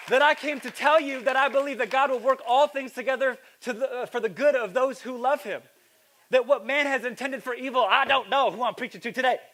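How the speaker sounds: high, loud, driving sermon voice; fervent preaching. Real, not acted. Preacher urging his church toward God's future, fervent preaching. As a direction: preach it out with full conviction.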